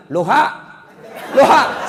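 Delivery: comic hollered greeting; mock phone shout. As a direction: call it out earnestly into the phone. Villager shouts hello reversed into phone.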